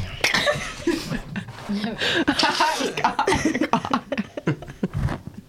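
long, high-pitched helpless laughing fit; real breathless belly laugh; uncontrollable laughter. Real, not acted. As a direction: laugh until your stomach hurts. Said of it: "My stomach hurts".

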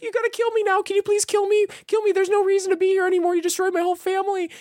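very high, loud squeaky begging; hopeless little man begging to be killed; tiny man pleading. Retold or imitated. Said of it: "He's like".

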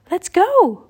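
high, loud rallying call; eager cry. A narrator's reading. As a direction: cry out to get the others moving. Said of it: "cried Annie".

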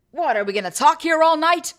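loud, high mock outrage; exasperated punchline. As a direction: burst out in hammed-up exasperation. Voicing exasperated Finn, joke punchline.

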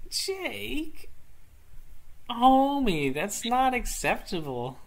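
high squeaky scolding voice; mock scolding. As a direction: scold him in a silly high voice.

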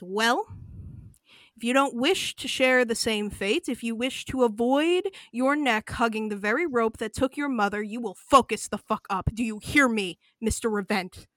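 loud and cold, a looming warning; menacing threat. Acted. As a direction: lean in hard, every word a threat. Cobras threatens V loudly.

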